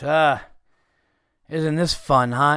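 dry, sarcastic jab at a customer. Retold or imitated. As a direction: say it like a weary clerk who's seen it all. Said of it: "he was just being sarcastic".

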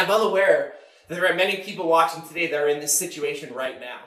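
usual speaking voice

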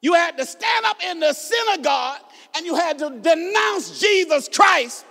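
measured pulpit delivery building to a high shout; rising, driving sermon voice; emphatic preaching. Real, not acted. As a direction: preach phrase by phrase, with long pauses. Preaching at full force.

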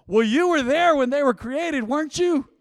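high, loud, sarcastic jab at the customer; mock the complainer at full volume; loud retort. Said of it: "with her loud voice".

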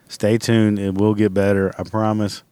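normal talking voice

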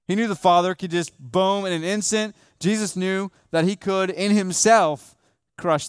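high, loud, slowing on in himself; fervent preaching. Acted. Pastor to congregation on God's power to save, climbing fervent preaching. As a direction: build up God's power to save, one phrase at a time.

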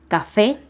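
"Café" stressed on FÉ, loud and rising; stress on last syllable. Shown as a demonstration. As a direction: punch the final é of "Café". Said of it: "también se acentúa la E".

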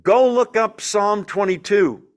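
shouting out. Acted. Jesus to the rabbis who are watching, shouting out. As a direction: call it out loudly to onlookers. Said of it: "it's like shouting out".

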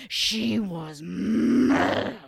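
slow, loud, seething growl; furious climax. A narrator's reading. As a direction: drag it out, boiling over with rage. Building anger, climax.

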